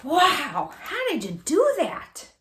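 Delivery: high, slow, wide-eyed wow; amazed boy. Acted. Andres marveling at Kino's cube solving, amazed.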